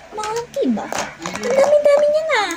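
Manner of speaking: mock outrage climbing high and fast; incredulous. Retelling to viewers her shocked reply to a rude buyer, high incredulous.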